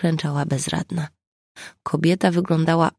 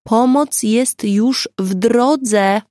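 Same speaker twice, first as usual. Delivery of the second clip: louder, higher call to a fainting woman; raised voice, rousing an unconscious woman; loud and clear; 6 semitones higher, 6 dB louder, much slower than usual. A narrator's reading. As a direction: call out clearly so she hears. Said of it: "powiedziała głośno i wyraźnie".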